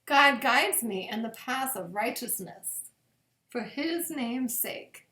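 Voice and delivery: slow, reverent psalm reading with long pauses. Acted. Pastor reading Psalm twenty-three at bedtime, slow and reverent. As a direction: let each verse land gently, slowly.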